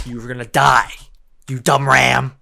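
loud furious yell at the ram; angry outburst. Real, not acted. Gamer yelling angrily at a ram in game. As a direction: yell at it like it wronged you. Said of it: "I got a little angry there".

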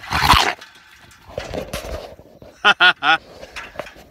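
loud airy laugh; long loud airy laughter; big laugh. Real, not acted. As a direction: let a breathy laugh burst out. Bursts out laughing.

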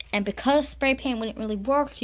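ordinary delivery

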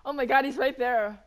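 loud panicked gasp; panicking gamer. Real, not acted. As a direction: blurt it out in alarm. Chased in game, sees the enemy.

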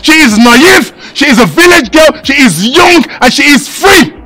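loud, indignant husband laying down the law; stern angry warning. Acted. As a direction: warn them off your wife forcefully. Husband warns two women off wife.

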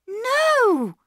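high, drawn protest; urgent high-pitched objection; cried out. A narrator's reading. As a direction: cry out in a child's high voice. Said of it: "cried Bobby".

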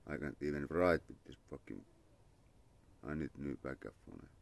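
ordinary delivery

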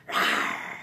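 very low, soft lion roar; deep, rumbling pretend roar. Shown as a demonstration. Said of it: "That's a lion".

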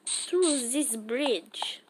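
playful put-on Russian accent, drawn out. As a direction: slip into a Russian accent for fun. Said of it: "said that in a Russian accent".

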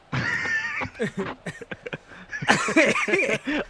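high, helpless laughter; real high-pitched laughing; laughing fit. Real, not acted. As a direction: crack up laughing. Cracking up at the game.

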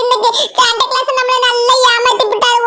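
usual speaking voice

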